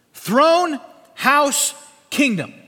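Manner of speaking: each word punched high and loud; emphatic proclamation. Real, not acted. Preacher drills the covenant's three components into congregation, loud and emphatic. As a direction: call out key words one by one, forcefully. Preacher hammering out key words.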